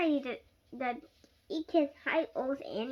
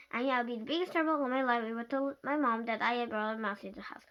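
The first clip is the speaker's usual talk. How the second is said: usual speaking voice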